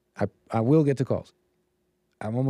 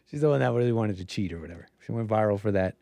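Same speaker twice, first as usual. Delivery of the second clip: usual speaking voice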